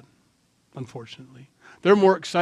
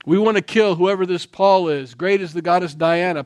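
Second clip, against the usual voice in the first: loud, raised mob chant; angry mob shout; 5 semitones higher, 8 dB louder than usual. Acted. Crowd in the theater demanding Paul, shouting loud. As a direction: bellow it like a furious crowd.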